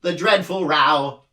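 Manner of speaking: mournful creature cry; grieving for a lost grandfather; anguished cry. A narrator's reading. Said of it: "cried the anguished din".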